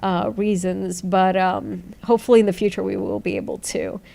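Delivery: ordinary talk, nothing marked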